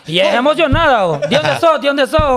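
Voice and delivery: imitating Uruguayan women. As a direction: playfully mimic an excited girl saying vos. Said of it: "me gustaba el acento de ellas".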